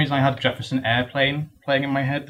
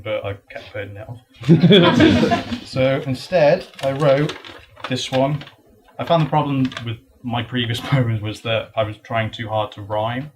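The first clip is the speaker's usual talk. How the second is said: ordinary talk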